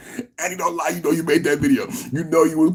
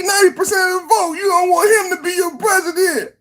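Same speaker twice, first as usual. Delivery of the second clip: much higher, wailing and shouting; mock crying plea; 11 semitones higher than usual. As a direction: beg Annie in a hammed-up crying rant. Mock crying rant at Annie.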